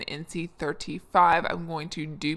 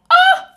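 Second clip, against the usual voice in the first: excited squeal; 14 dB louder than usual. Real, not acted. Sudden squeal mid-intro.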